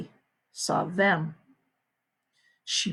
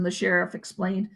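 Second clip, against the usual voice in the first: ordinary delivery, nothing marked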